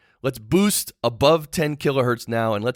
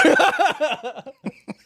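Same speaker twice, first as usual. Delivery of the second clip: high laugh. Real, not acted. Laughs at own joke.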